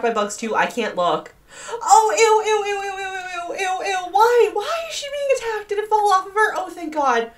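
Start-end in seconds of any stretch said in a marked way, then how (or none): 1.7-7.3 high, whining squeals of ew; panicked disgust. Real, not acted. Player watching bugs attack her, high panicked squealing. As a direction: squeal in revulsion, easing into relief at the end.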